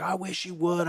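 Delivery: mock yell back at a cop; retold yelling. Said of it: "I'm, like, yelling at this cop".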